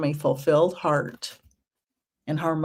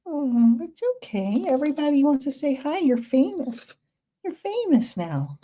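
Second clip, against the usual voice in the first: high cooing cat voice; sweet high-pitched coo; soothing a pet; 8 semitones higher than usual. Real, not acted. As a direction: reassure a nervous cat in high pet talk.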